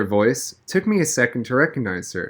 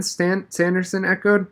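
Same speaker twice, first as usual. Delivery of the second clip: ordinary delivery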